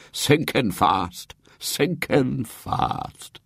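very slow, drawn-out doom; sepulchral. A narrator's reading. Uncle John reporting on Great-aunt Jane, sepulchral. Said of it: "in a sepulchral voice".

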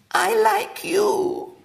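put-on high, slow, trembling girl's voice; a small girl on the verge of tears; quavering little girl. A narrator's reading. Little girl asks William if he likes her, quavering.

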